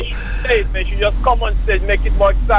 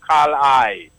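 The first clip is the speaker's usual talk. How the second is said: "Carl I" in a Jamaican twang, a touch lower; "Carl I" with a light Jamaican lilt; 5 semitones lower than usual. Shown as a demonstration. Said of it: "You gotta say it in the Jamaican twang".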